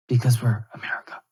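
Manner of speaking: whispered mock politician line; hushed mock-politician boast. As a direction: breathe it out as a sly aside. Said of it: "politicians like".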